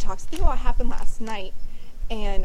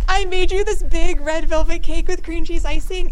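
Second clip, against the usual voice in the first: higher-pitched, lightly acted eager offer; God offering cake; 6 semitones higher, 3 dB louder than usual. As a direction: play God proudly presenting a gift. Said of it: "but God's like".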